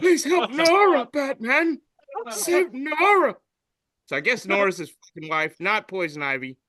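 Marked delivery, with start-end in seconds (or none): 0.0-3.3 high, loud pleading cry; imitating the game character. Quoting the game villain, a high loud pleading plea. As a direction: beg Batman for help, high and loud. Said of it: "he said".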